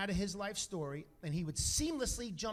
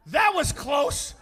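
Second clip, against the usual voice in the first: mock relief; 7 semitones higher, 11 dB louder, much slower than usual. Choking father relieved after near miss.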